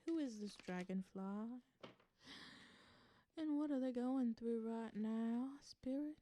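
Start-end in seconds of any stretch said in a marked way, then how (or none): none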